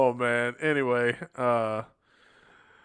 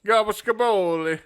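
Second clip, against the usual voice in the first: mock Italian accent; 7 semitones higher, 7 dB louder than usual. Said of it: "I'm gonna say it like that anyway".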